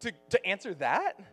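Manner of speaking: high, halting disbelief; mock incredulity. Student asked to answer for Jesus, in mock disbelief. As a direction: stammer it out as if wrongly picked. Acting out panicked incredulity when challenged.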